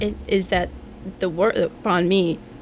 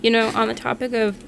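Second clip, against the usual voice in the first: ordinary delivery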